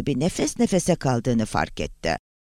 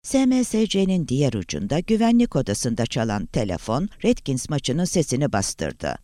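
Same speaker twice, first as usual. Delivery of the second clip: ordinary delivery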